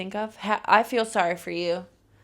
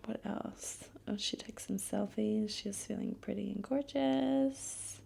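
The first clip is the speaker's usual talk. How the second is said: whisper; 8 dB quieter than usual. Acted. Whispered aside about selfies.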